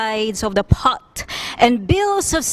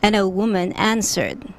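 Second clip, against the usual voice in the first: plain reading voice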